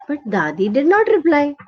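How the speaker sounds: plain reading voice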